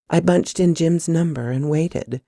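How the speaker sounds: ordinary delivery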